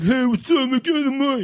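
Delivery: high-pitched mock hustler pestering for cash; pushy panhandler. Mocking a scummy panhandler's approach, high pushy impression. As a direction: imitate a pushy beggar way up high. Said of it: "didn't do it in a way of like".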